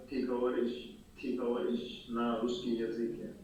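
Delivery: ordinary talk, nothing marked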